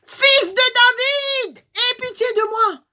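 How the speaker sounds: very high, slow, loud plea. Acted. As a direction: stretch it out and call with all your strength. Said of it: "Qu'il crie encore plus fort".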